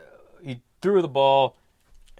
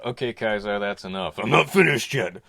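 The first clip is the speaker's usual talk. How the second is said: normal talking voice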